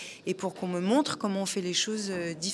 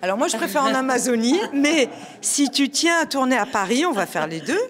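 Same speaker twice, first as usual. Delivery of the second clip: louder, faster and higher; bubbly, eager answer; excited; 5 semitones higher, 9 dB louder than usual. Real, not acted. Playful reply about shooting film. (enthousiaste et rieuse)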